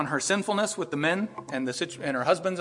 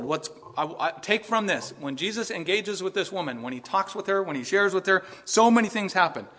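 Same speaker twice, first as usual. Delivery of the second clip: normal talking voice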